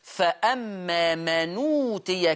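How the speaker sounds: slow careful reading of فأما من أوتي; careful teaching pronunciation; naql demo. Shown as a demonstration. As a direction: say فأما من أوتي slowly, the nun carrying the vowel. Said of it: "فيصير النطق".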